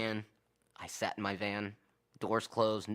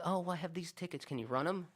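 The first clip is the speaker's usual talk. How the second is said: put-on high, soft old-lady request; higher-voiced elderly customer asking; imitated older woman; 7 semitones higher, 4 dB quieter than usual. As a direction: pitch it up softly like an elderly customer. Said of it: "it was an older woman".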